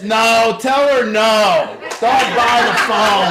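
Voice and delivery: loud, laughing shout, slow on tell her no. Retold or imitated. Kid in back seat yelling at mom's call, loud laughing shout. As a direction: yell it across the car, half laughing. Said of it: "He goes".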